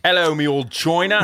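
mock East End Cockney; Cockney accent. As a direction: do a bad Cockney impression. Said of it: "raised with a Cockney grandfather".

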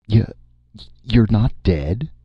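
a shaky startled question. Acted. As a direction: Martin afraid his guest will die. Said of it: "Martin inquired shakily".

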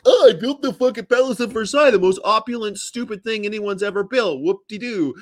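high, mocking, pompous boast; loud at first, then easing into a mocking sneer; Louis the Fourteenth. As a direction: crow it with sarcastic grandeur. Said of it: "Louis the fourteenth always comes up".

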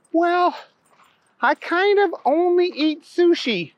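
put-on high-pitched little girl voice. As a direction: talk high like a small girl.

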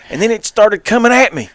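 high, loud alarmed retelling; mock alarm. Retelling Brody's account of the charge, mock-alarmed and loud.

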